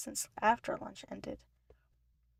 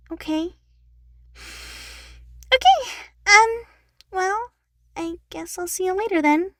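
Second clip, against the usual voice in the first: much higher, louder, halting little sister; awkward Eleanor; 10 semitones higher, 11 dB louder than usual. Acted. Eleanor says goodbye awkwardly to Brittany. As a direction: fumble the goodbye, hurt. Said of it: "Eleanor said awkwardly".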